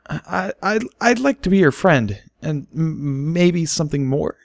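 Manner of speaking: high, nervous voice breaking on words; voice cracking. A narrator's reading. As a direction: push through a nervous confession, cracking. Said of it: "his voice cracking several times".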